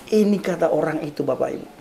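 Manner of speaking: normal talking voice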